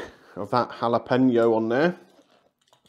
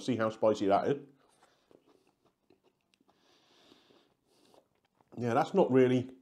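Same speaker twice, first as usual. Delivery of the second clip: talking while chewing. Real, not acted. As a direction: mouth partly full. Seen 1: Ate jalapeno at -5; mouth working, fingers at lips early on.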